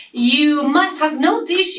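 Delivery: normal talking voice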